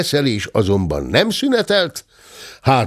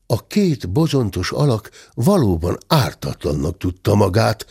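usual speaking voice

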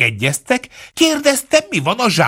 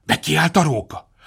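ordinary delivery

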